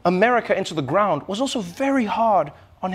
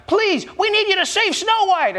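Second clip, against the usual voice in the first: much higher, squeaky mock plea; pleading dwarves; 13 semitones higher than usual. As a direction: beg like tiny storybook dwarves. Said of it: "the dwarves would be like".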